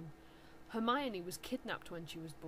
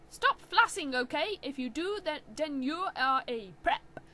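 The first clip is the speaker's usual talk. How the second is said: scolding author; 6 semitones higher, 7 dB louder than usual. Acted.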